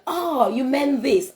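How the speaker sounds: high, loud, drawn-out mock realization; stretched-out "oh" of playful correction; exclaimed correction. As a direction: imitate amused colleagues, slow and high. Colleagues acted correcting her pronunciation.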